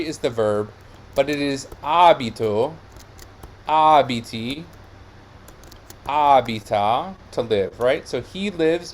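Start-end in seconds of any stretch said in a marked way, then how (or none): 1.8-2.7 abito as AH-bi-to; stress on first syllable. Shown as a demonstration. Teacher giving the first person of abitare, first-syllable stress. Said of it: "it takes the same stress as the first person". 3.7-7.1 abiti, abita as AH-bi-ti, AH-bi-ta; stress on first syllable. Shown as a demonstration. Teacher reciting forms of abitare, first-syllable stress. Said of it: "it takes the same stress as the first person".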